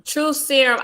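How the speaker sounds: ordinary talk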